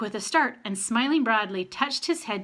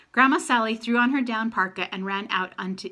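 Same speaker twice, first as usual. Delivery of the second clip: usual speaking voice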